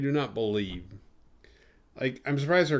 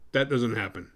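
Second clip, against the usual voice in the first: ordinary delivery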